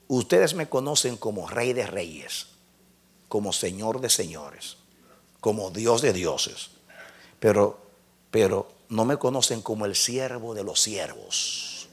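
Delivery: God speaking gently. Retold or imitated. (Dios le habla al predicador, en voz baja y suave)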